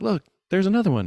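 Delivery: high, loud look there's another one; excited shout. Retold or imitated. Stepdaughter calling the family outside, high and loud. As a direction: call it out, thrilled.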